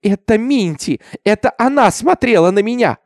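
high, loud accusing cry; sobbing scream. A narrator's reading. Hysterical girl says Minty looked at her, crying out. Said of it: "закричала она, рыдая".

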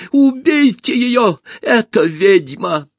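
high, loud, drawn-out cry; shouted order. A narrator's reading. Usurper inciting bodyguards against the empress, high shouting. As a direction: yell it out as an accusation. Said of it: "крикнул он телохранителям".

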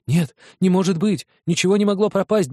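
ordinary delivery

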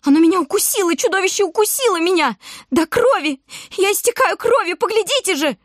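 shrieking to onlookers she's bleeding; hysterical wail. A narrator's reading. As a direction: play the bitten victim to the crowd. Grocer's wife fakes a bite.

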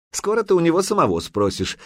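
high-pitched, needling comeback; snide retort. A narrator's reading. Лысина answers the shouting warrior, snidely. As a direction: needle him with a mocking reply. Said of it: "ехидно отозвался Лысина".

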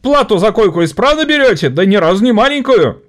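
high, loud accusation; angry worker. Acted. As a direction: call out the boss. (возмущённо)

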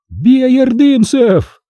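very high, loud, drawn-out yell; battle cry. Acted. Young prince leaping forward at the Tatars, battle cry. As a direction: charge and rally everyone.

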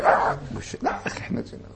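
hushed, breathless whisper of a man being pinched; pained whisper. Retold or imitated. Imam pinched before the crowd, whispering in pain. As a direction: gasp it out under your breath, as if hurt. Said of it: "خلي قدام الناس يقول".

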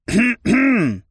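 pointed throat-clearing; loud, deliberate ahem; loud cough. A narrator's reading. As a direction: cough loudly to get attention. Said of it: "Громко кашлянул я".

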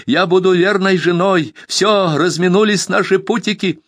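high and loud, final vow; desperate shouted vow; still shouting. A narrator's reading. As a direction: cry out the decision. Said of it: "закричала Тайка".

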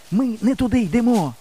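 high, winded protest; nearly breathless. A narrator's reading. Exhausted boy warns they are lost, nearly breathless. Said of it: "сказав я, майже задихаючись".

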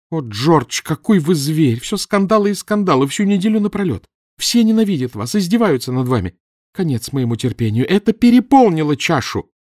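high, loud shrill-free tirade at full voice; angry scolding. A narrator's reading. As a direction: pour out her fury at him. Wife berates her husband. (жена отчитывает мужа, громко и гневно)

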